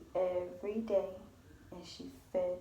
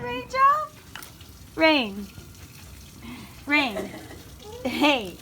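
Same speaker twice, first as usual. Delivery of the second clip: high calling voice; 11 semitones higher, 14 dB louder, much slower than usual. Real, not acted. Calling baby to get attention.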